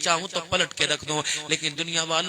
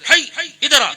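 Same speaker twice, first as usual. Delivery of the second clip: angry call; 11 semitones higher, 14 dB louder, faster than usual. Shown as a demonstration. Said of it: "یہ غصے کا لفظ ہے".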